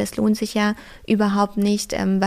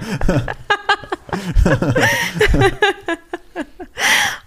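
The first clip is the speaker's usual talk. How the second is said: high rising laughter; real amused laugh; long laugh; 9 semitones higher, 5 dB louder than usual. Real, not acted. Laughs at Berghain suggestion.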